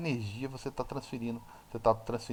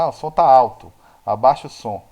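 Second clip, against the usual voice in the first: louder, acted-out grumble about the volume; imitated complaint; 13 dB louder than usual. Someone asking for the sound to be turned down, loud mock complaint.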